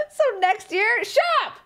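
high laughing shut up; real laughter breaking through; laughing protest. Real, not acted. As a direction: burst out laughing while telling them off.

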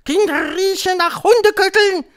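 shrieked, very high and loud; screeching Grand High Witch. A narrator's reading. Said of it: "kreischte die Hoch". (kreischende Hexe, sehr hoch und laut)

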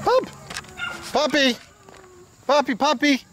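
squeaky high-pitched call to the puppy. Real, not acted. Calling puppies with high pet voice.

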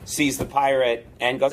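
usual speaking voice

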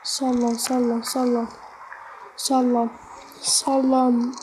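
usual speaking voice

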